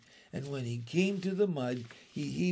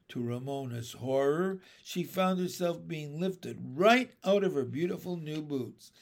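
plain reading voice

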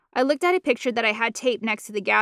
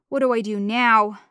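plain reading voice

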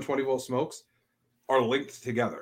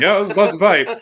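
higher, louder mock-obvious reply; deadpan the obvious, loud and sarcastic; sarcastic retort; 7 semitones higher, 13 dB louder than usual. Telling the resident the obvious, loud sarcasm. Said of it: "And I was like".

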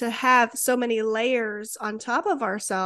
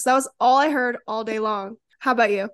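ordinary delivery

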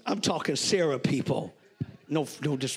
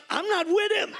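high, mildly shouted mock denial; panicked disowning; 12 semitones higher than usual. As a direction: disown him in a high, half-laughing yelp. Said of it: "I was like".

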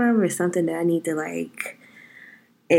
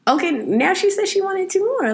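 mocking herself; 11 semitones higher than usual. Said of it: "the way this man threw his hands up".

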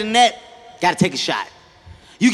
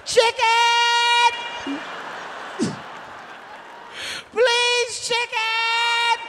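high, frantic cries; hysterical panic; 14 semitones higher, 4 dB quieter, much slower than usual. Retold or imitated. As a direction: wail it like a castaway finally rescued. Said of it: "I lost it".

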